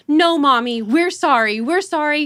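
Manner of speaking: put-on insistent child-voice apology; imitating apologetic daughters. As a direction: rush to comfort Mom like young girls. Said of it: "my older two were like".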